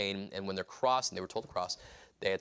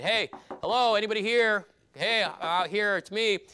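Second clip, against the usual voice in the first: raised, high call through a door; 9 semitones higher, 5 dB louder than usual. Retold or imitated. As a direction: call out to be let in at night. Peter knocking, calling through door.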